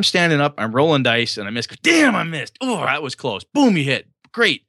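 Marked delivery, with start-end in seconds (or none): 1.7-4.6 higher-pitched, excited combat calls; raised voice, into it. Shown as a demonstration. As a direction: react big to each dice roll. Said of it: "you raise your voice, you get into it".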